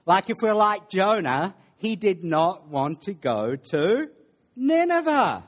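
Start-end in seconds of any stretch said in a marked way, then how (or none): 4.5-5.4 NINEVEH high and loud, then falling; dramatic reveal. Acted. Preacher names the city Jonah dreaded, dramatic high reveal.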